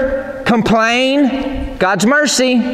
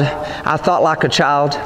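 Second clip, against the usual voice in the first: solemn scripture quote; 8 semitones lower, 3 dB quieter, slower than usual. Acted. Quoting Paul, solemn reading.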